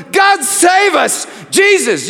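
loud, high cry, slowly stretched; crying out to God. Acted. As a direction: call out to God in desperate awe. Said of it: "and I cried out".

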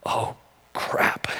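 soft breathless whisper of realization; whispered dismay. Retold or imitated. Father realizes his daughter believes it, whispering. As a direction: whisper it as the sinking thought hits. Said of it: "I realized".

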